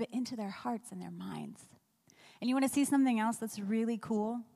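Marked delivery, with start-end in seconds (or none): 0.0-1.7 hushed whisper of a secret promise. Acted. Preacher to congregation, whispering God's promise. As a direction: drop to a breath, as if confiding.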